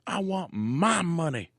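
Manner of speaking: very high, slow, insistent demand; indignant dancer. Retold or imitated. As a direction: hammer each demand home, aggrieved. Dancer ranting at owner.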